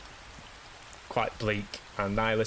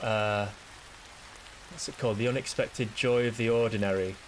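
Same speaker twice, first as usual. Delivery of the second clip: usual speaking voice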